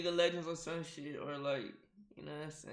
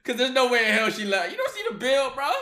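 laughing; 6 semitones higher, 10 dB louder than usual. Real, not acted. Laughing at player's answer.

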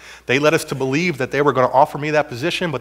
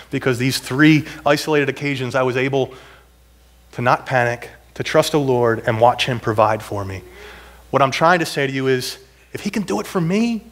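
ordinary talk, nothing marked

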